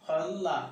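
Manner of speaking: "খল্লা" joined, with a double l; joined doubled lam. Shown as a demonstration. Teacher joining the spelled word for learners, joined doubled lam. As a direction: hold the l in "খল্লা", then open into la. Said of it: "এর উচ্চারণ হবে".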